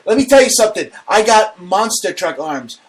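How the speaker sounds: hammed-up tough-guy bluster; blustering boast. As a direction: brag at full volume, then stretch it out. Boasts back after being teased.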